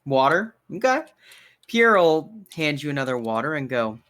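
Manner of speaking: ordinary talk, nothing marked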